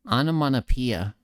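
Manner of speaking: through the nose. Shown as a demonstration. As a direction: say "Onomatopoeia" with the air through your nose. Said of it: "the first one was me speaking through my nose".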